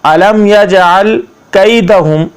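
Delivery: slow word-by-word tajweed; slow Arabic recitation for learners; Quranic recitation lesson. Shown as a demonstration. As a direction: draw out the long vowels, pause after each phrase. Models the phrase.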